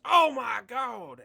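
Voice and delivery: drawn-out, very high, booming commentator shout; full-volume wrestling-commentary impression; Jim Ross. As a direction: bellow it slowly like a shocked wrestling announcer. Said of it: "I gotta do the Jim Ross".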